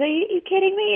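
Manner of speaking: can't believe it, voice jumps way up; incredulous disbelief. Retold or imitated. Retelling her friend's sudden recovery, incredulous and high. Retold disbelief, repeated much higher.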